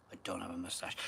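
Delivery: breathy whispered denial, almost unvoiced; whispered aside. Real, not acted. Streamer whispers a denial about his moustache, hushed whisper. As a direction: drop to a private whisper for a quick denial.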